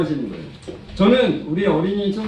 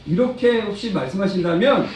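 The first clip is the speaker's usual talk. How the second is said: ordinary talk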